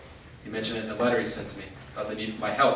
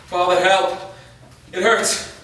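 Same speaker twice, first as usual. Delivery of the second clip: crying out in pain, loud and high, rising to a scream; a delirious plea to his father, louder each time; pain-wracked shouting that climbs higher; feverish cries for help; 10 semitones higher, 12 dB louder, slower than usual. Acted. Delirious wounded man cries out.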